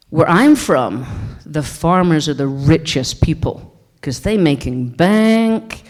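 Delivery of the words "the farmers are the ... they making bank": usual speaking voice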